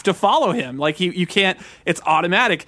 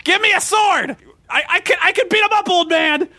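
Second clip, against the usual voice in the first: put-on frustrated kid; 10 semitones higher than usual. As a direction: whine like a kid who wants a real sword. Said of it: "I was so frustrated 'cause I was like".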